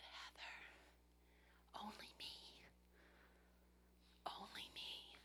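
soft unvoiced whisper, slow; whispered still small voice. God answering the worried believer in a soft slow whisper.

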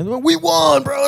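bandwagon fan brag. Retold or imitated. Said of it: "quoting a Baltimore bandwagon fan".

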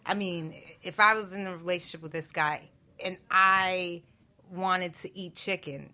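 ordinary talk

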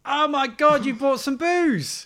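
high, loud excited gasp; thrilled disbelief at the booze; delighted shock. Real, not acted.

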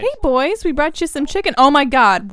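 mom voice. Acted. Mom arrives at the stunt.

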